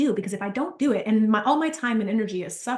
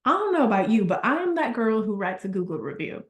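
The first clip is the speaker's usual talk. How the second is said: ordinary delivery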